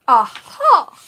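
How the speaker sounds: loud, high burst of discovery; triumphant aha. Real, not acted. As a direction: shout it like a find. Finds the missing clips.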